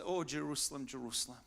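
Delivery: soft, understated lament; mild lament. Acted. Jesus lamenting over Jerusalem, understated soft lament. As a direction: lament gently, sinking away. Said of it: "Jesus lamented over Jerusalem".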